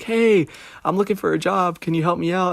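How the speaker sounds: high, sugary-polite job seeker voice; all nice and kind. Retold or imitated. Teenager asking a shop clerk for work, sugary and high-pitched. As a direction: sound extra sweet and eager to please. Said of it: "like, all nice and kind".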